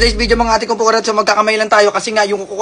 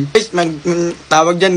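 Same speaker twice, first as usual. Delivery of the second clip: normal talking voice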